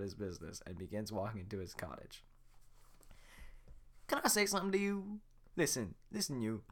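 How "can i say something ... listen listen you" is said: higher eager donkey voice; fast-talking admirer; Donkey fanboying over Shrek; gushing Donkey. Acted.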